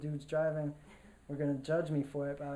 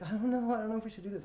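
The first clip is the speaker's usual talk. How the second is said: anxious mom; 7 semitones higher, 5 dB louder, faster than usual. Retold or imitated. Mom hesitating before ambulance leaves.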